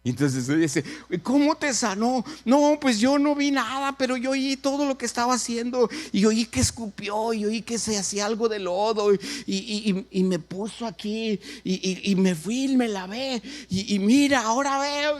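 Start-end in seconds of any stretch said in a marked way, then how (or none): 1.1-2.2 high-pitched, eager acted question; amazed question. Girl asking a healed man how it happened, amazed. 2.4-15.2 high, tumbling acted account; excited testimony. Healed blind man recounting the mud miracle, excited.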